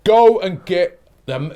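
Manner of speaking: ordinary talk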